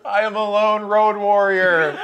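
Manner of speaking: slow, loud, hammed-up hero line through laughter; mock lone road warrior. Player mocking their own answer, slow hammed-up hero voice. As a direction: announce yourself like a cheesy action hero. Hammed-up heroic self-introduction.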